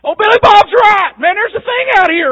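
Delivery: high, loud frightened yell of a fisherman; panicked shout. Retold or imitated. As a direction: shout it like terrified sailors seeing a ghost. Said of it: "And they thought".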